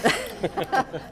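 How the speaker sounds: a quick real laugh at her own mix-up; amused laugh. Real, not acted. Moderator laughing at her mistaken question, amused laugh. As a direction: laugh it off lightly.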